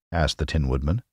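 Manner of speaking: usual speaking voice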